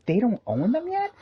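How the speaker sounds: high, incredulous question; shocked disbelief. Retold or imitated. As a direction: sound completely shocked. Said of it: "And I was like".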